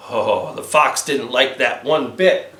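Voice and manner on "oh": ordinary delivery, nothing marked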